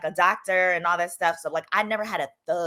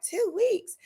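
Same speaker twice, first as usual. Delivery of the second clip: much higher, disbelieving "Two weeks?"; surprised; 12 semitones higher than usual. Retold or imitated. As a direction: echo it back in mock shock. Surprised at their long holiday.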